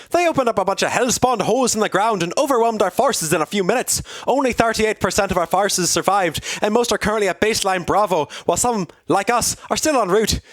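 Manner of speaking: high-pitched character voice with a put-on Irish lilt; Captain Cherry's Irish-style accent. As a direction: play the officer reporting losses in a high, hammed-up Irish accent. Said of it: "came the saddened reply from Captain Cherry".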